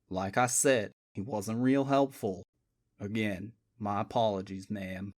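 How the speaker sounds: a calm, apologetic detective; deep-voiced sheriff type, polite; southern detective. A narrator's reading. Boone's low southern detective voice.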